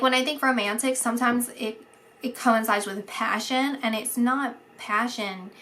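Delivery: normal talking voice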